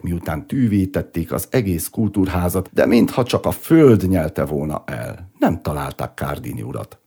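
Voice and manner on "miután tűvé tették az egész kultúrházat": plain reading voice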